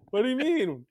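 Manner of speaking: high, incredulous imitation of a kid; bewildered child. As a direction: question it like a baffled little son. Acts bewildered son questioning him.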